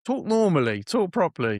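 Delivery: teacher scolding a pupil about his slang, stern. Pretend. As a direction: scold the kid like a strict teacher. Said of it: "I'd say".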